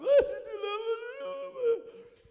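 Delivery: loud, high comic bawling; hammed-up wailing sobs, very high; mock ugly crying. Man overcome during worship songs, mock ugly crying. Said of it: "I am ugly crying. I'm like".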